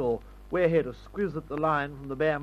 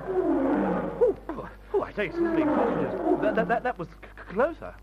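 high, stuttering nerves; jittery British chap losing his nerve; frightened stammer; 7 semitones higher, slower than usual. Acted. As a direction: trip over the words in fright. Frightened by a nearer roar.

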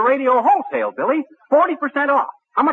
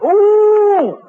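high, loud drawn-out "ooh"; thrilled, high-pitched oooh; delighted "ooh"; 14 semitones higher, 7 dB louder than usual. Acted. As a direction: squeal an excited ooh. Eager squeal at waffle iron hint.

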